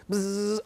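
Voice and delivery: short voiced buzz; imitated buzz. Shown as a demonstration. Teacher hunting for a letter on the board, brief buzz.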